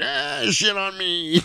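high, drawn-out mock howl; squealed, stretched-out cry; pained wail. Repeats the howl, hand stabbed.

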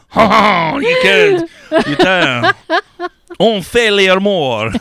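loud, high, hammed-up French accent; mock French accent. Man hamming up bedroom talk for co-host, French accent. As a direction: play a laughing French seducer. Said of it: "Did you say it with a French accent?".

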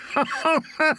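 hearty laugh from the chest; full rich laugh. Acted. Sir Hyle laughing off Brienne's threat, full rich laugh. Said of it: "He had a full, rich laugh".

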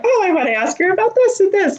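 high, fast, eager self-quote; eager inner voice. Retold or imitated. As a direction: rush it out high, bursting with questions. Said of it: "I'm like".

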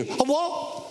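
high rising question; startled disciple. Acted. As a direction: act out surprised disbelief. Peter startled by promise to return.

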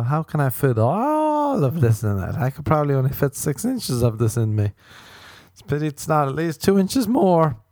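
sarcastic swooning date, lightly voiced; mock impressed woman. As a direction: ham up a woman faking amazement. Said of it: "she's gonna be like".

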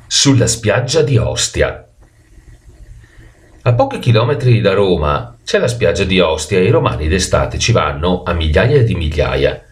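plain reading voice

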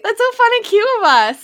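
high, gushing voice. Real, not acted. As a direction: gush about it. Gushing over living together.

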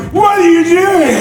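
high, loud mock outrage; outraged comic nerds. Comic nerds to the man standing after the movie, outraged. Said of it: "all the comic nerds are like".

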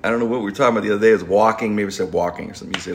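ordinary delivery

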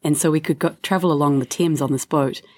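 ordinary talk

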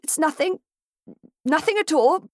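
an injured gentleman bravely making light of pain; brave through pain. A narrator's reading. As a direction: brush off the hurt ankle. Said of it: "saying bravely".

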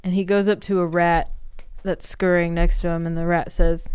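ordinary talk, nothing marked

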